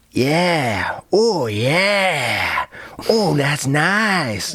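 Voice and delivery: slow, drawn-out porn-style approval; imitated leering onlooker. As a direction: stretch each word in mock arousal. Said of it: "just being like".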